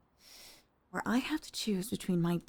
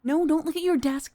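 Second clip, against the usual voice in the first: louder, higher, cutting off the evasion; indignant command; 5 semitones higher, 5 dB louder than usual. Acted. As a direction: scold him for looking away. Confronting boss who avoids her gaze.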